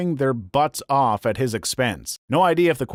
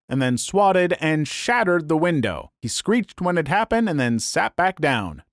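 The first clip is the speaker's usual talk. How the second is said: ordinary talk, nothing marked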